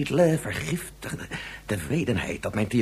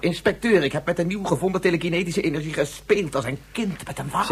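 agitated outburst; 7 semitones higher, 4 dB louder than usual. Acted. Distraught scientist confesses to inspector.

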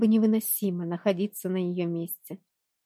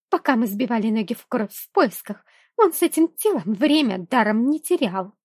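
spiteful hiss building louder; snide hiss; 3 semitones higher, 5 dB louder than usual. A narrator's reading. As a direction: hiss it out with venom. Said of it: "ехидно прошипела змея Сара".

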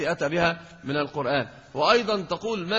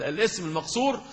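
ordinary delivery

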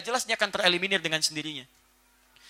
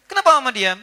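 put-on pushy child question; 5 semitones higher, 8 dB louder than usual. Child asks mother, voiced.

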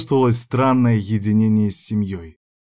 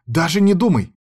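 higher, sharp warning; mildly hissed threat; 7 semitones higher, 4 dB louder than usual. A narrator's reading. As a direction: warn him off crossly. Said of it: "шипела она".

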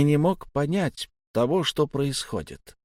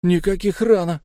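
loud, high-pitched objection; indignant youngster's retort; 7 semitones higher, 5 dB louder than usual. A narrator's reading. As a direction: protest eagerly at being left out. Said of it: "возмутился Фари".

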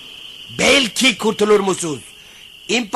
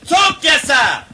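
hailing shout, raised pitch; cheering; 6 semitones higher, 3 dB louder, slower than usual. Acted. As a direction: call out the cheer. Hailing the new emperor.

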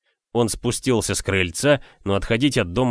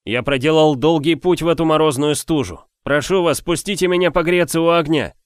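high, louder pleading; pleading stranger; 7 semitones higher, 5 dB louder than usual. A narrator's reading. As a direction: beg the household to open up. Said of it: "пробормотали". (умоляющий путник)

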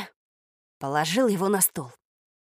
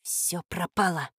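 soft, slow, sinking inner voice; despairing thought; 3 semitones lower, 6 dB quieter, slower than usual. A narrator's reading. Boy thinks all is lost, despair. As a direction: let it out slowly and hushed. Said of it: "пронеслось у меня в голове".

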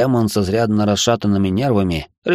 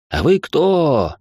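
stunned question; 8 semitones higher, much slower than usual. A narrator's reading. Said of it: "ошарашенно спросили в один голос горе-маги".

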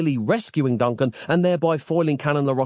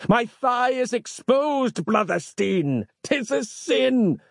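very high, slow, hammed-up wail; melodramatic, drawn-out anguish; wincing in pain; 13 semitones higher, slower than usual. A narrator's reading. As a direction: lament it like a wounded zealot. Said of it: "he winced".

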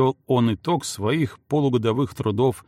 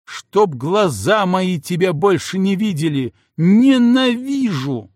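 яростный крик; 5 semitones higher, 5 dB louder than usual. Acted. Жена кричит, уходя.

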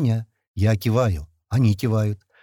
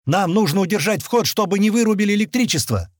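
high, raised voice; urgent shouting; 6 semitones higher, 4 dB louder than usual. A narrator's reading. Explaining the plan to the men, shouting. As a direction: press the plan on them loudly.

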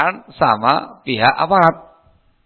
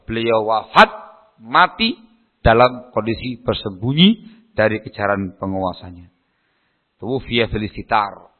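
ordinary delivery

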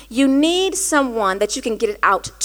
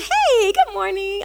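high, drawn-out happy greeting; cheerful greeting; 8 semitones higher, slower than usual. Acted. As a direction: put on a slow, singsong cheeriness.

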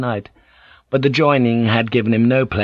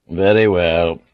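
fake reluctance; 3 semitones lower than usual. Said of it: "Toranaga agreed reluctantly".

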